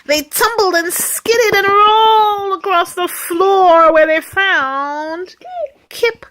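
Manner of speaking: high, loud and drawn-out build; dramatic storytelling. A narrator's reading. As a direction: stretch it out as the tumble builds. Dramatic build as cats tumble in.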